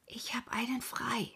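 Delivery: leise gehaucht, ohne Stimme; flüstere es verschwörerisch; geflüstert. A narrator's reading. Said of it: "Der Fuhrberger flüsterte". (ganz leise geflüstert)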